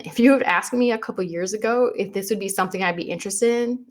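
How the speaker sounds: usual speaking voice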